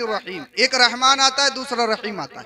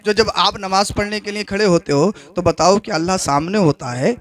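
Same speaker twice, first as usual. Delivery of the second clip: normal talking voice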